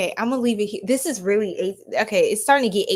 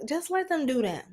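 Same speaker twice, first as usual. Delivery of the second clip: usual speaking voice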